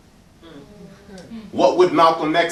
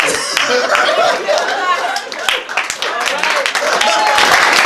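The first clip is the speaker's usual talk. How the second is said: laughing; 4 dB louder than usual. Real, not acted. Laughs after joke to audience.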